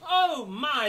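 ordinary delivery, nothing marked

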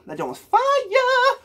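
high, slow, stretched hype cry; hyped shout. Real, not acted. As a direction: sing out your praise, dragging fire.

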